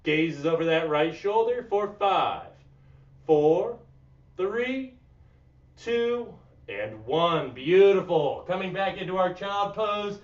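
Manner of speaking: quiet, calm instructor guiding stretches; soft cool-down cueing. Acted. Yoga coach guiding the class through breathing, soft and calm.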